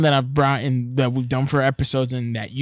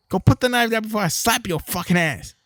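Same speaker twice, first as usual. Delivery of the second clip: slightly higher, mild mock threat; hint of an angry dad; 8 semitones higher, 3 dB louder than usual. The dad telling the kid to drop the knife, mock threat. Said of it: "he's all like".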